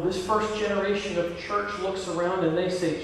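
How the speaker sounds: normal talking voice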